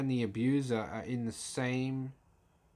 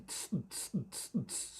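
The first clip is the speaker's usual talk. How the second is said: a soft unvoiced cymbal hiss fading out; beatbox hi-hat; 9 dB quieter than usual. Shown as a demonstration. Showing both channels playing together, hi-hat. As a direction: let the hi-hat loop trail away. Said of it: "it's going".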